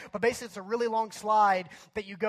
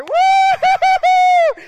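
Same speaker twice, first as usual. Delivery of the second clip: long, high, loud whoop; rebel whoop; 18 dB louder than usual. Retold or imitated. Everybody in line defying the guard, whooping. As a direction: whoop like a rowdy crowd.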